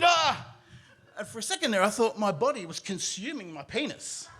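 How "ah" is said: loud, high shriek; horrified scream. Retold or imitated. Storyteller looking down at the toilet, screaming in horror. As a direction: scream in shock. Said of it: "I screamed".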